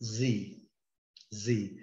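Z said as a long zee, no d at the end; American zee. Shown as a demonstration. Teacher models the American Z for students. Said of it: "E no inglês americano".

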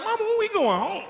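put-on a small kid pleading with his mother; whining kid. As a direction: beg to go home. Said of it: "You be like".

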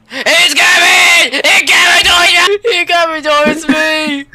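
loud panic, easing toward the end; panicked shout. Real, not acted. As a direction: shout a frantic warning mid-chase. Monster chases the player.

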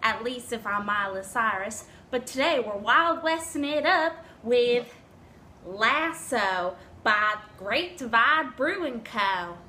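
put-on Tennessee twang. Vlogger greets viewers as a country star, southern accent. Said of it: "my [name] southern accent".

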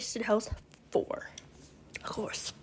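hushed whispered aside; whispering. Real, not acted. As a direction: whisper it like a secret. Aside about girlfriend's outing.

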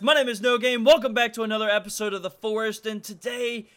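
loud, high channel-opener greeting; hyped YouTuber intro. Acted. YouTuber greeting his viewers at episode start, loud and high. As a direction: welcome the viewers back, pumped up.

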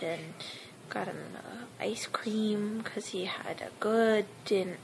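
soft unvoiced whisper. Real, not acted. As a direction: whisper the story softly. Whispered vlog narration.

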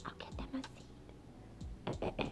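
barely audible whisper; hushed note to the camera; whispered aside. Real, not acted. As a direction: whisper it to yourself. Aside, away from the birds.

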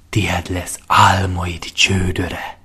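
slow, seductive whisper. Acted. Lentó tempts Lyra with her dream stallion, slow whisper. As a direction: breathe the promise slowly.

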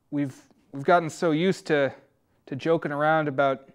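usual speaking voice

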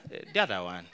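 very low, soft evasive mumble; voice going down. Acted. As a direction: sink into an embarrassed undertone. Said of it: "there's where our voice goes down".

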